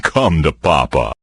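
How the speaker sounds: normal talking voice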